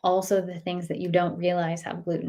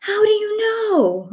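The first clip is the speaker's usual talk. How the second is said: much higher, slow pleading question; high plaintive kid; 14 semitones higher, 4 dB louder, slower than usual. Retold or imitated. Son to mom, high and pleading. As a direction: voice the bewildered son, drawn out high. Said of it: "And he's like".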